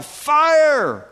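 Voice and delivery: sudden high, loud shout of fire; alarmed high yell of fire. Pretend. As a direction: cry it out like you spotted a burning house.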